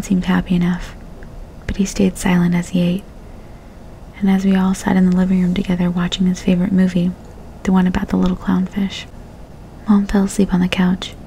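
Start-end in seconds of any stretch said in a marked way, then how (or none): none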